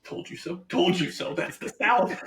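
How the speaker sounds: lightly gloating, laughing on the last words; gloating told you so. Retold or imitated. Student gloating at class about the South, playful laughing gloat. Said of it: "and I was like".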